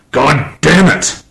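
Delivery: captain losing his temper, furious curse; angry snap. A narrator's reading. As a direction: explode at the darkness. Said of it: "Miller snapped".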